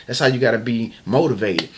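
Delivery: ordinary talk, nothing marked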